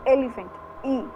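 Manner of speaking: ordinary talk, nothing marked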